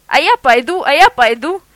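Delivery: loud eager yapping; small dog barking its decision. A narrator's reading. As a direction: yap it out gleefully. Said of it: "загавкал прихвостень".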